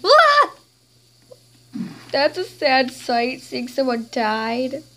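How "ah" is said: sharp high shriek of fright; high startled cry; startled scream. Acted. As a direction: shriek at the sight. Sudden fright at a death.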